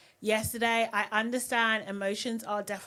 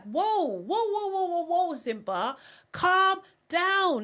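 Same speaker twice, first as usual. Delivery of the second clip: high, rising whoa-whoa to calm him; pitched-up call to settle him down; reacting to the screen, calling out to Simba in alarm; 7 semitones higher than usual. Real, not acted.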